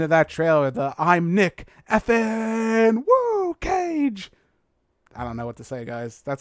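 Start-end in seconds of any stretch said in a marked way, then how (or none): 1.0-4.3 slow, drawn-out high holler; over-the-top movie quote. Acted. Podcaster quotes trailer end line, hammed-up and drawn out. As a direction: stretch it into a hammy whoop.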